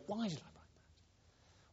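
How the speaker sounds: whispered to yourself in regret; regretful whisper. Retold or imitated. Listener recalling something said, whispering in regret. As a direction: breathe it out like a private self-reproach.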